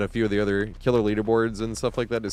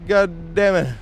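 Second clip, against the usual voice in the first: loud high angry curse; frustrated curse; 8 semitones higher, 6 dB louder, much slower than usual. Real, not acted. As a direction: spit it out in frustration. Frustrated after a mistake.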